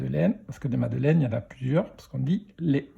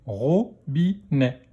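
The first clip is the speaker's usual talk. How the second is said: slow "Robinet", split into ro, bi, nè; syllable by syllable; much slower than usual. Shown as a demonstration. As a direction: sound out "Robinet" in three beats. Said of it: "dans quelle syllabe".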